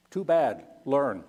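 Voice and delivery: ordinary talk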